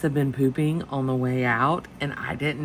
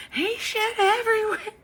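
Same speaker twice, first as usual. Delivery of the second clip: very high, squealed retelling; crying from laughing. Retold or imitated. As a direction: imitate a confession squealed through laughing tears. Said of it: "I am crying from laughing so hard".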